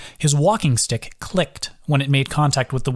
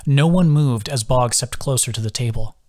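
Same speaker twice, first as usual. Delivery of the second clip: ordinary delivery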